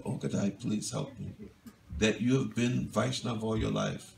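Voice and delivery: soft, low begging, almost a whisper; hushed pleading. Retold or imitated. Nimai quietly begs Gadai for help, hushed. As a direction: plead quietly, as if near tears.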